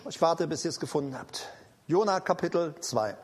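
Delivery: low, quick, soft practical aside; murmured aside to the congregation; quiet aside. Real, not acted. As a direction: drop out of the preaching to wait for them. Aside to congregation finding verse.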